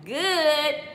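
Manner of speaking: usual speaking voice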